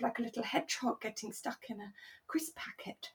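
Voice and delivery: hushed storytelling whisper; softly breathed, tender dismay. Real, not acted. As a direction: whisper it like a sad bedtime detail. Sad childhood story image.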